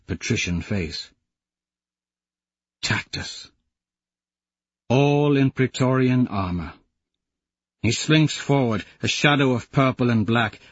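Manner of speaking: ordinary talk, nothing marked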